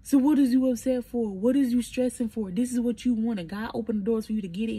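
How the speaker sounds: forceful, exasperated reproof; mother's scolding. Retold or imitated. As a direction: imitate a mom setting her child straight. Imitating mother scolding her.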